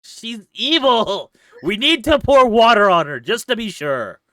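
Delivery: high, loud panicked warning; panicked shouting. Acted. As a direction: urge them in alarm. Panicked about witch.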